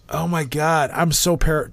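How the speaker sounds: ordinary talk, nothing marked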